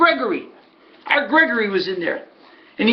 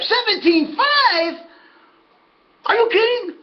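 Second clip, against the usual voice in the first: high, slow seventeen five; incredulous; 12 semitones higher, slower than usual. Retold or imitated. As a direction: drag it out in disbelief. Groom hears the price.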